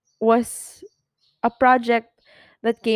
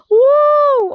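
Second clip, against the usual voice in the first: sharp high whoop to shake off tears; high loud woo; 9 dB louder than usual. Real, not acted. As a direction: blow out the emotion with a loud woo. Shaking off tears mid-talk.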